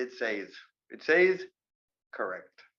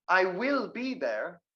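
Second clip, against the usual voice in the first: slow, higher, weighty stress on "will"; emphatic WILL; 4 semitones higher, slower than usual. Shown as a demonstration. Teacher showing how no contraction sounds, emphatic "will". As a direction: lean hard on "will", kept uncontracted. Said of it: "sounds like you're trying to emphasize".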